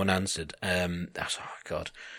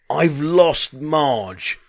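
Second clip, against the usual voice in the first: put-on loud, slow German-accented wail, pitched up; 6 semitones higher, 5 dB louder, much slower than usual. German man announcing he's lost Marge, German accent. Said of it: "it was in German accent".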